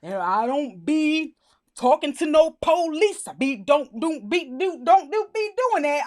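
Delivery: loud, halting caricature; mock scripted dialect. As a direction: stumble slowly, then pile up words in mock dialect.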